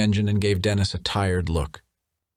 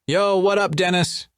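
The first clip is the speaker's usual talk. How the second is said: calling out; 12 semitones higher than usual. A narrator's reading. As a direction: call across the workshop. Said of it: "yelled a middle-aged".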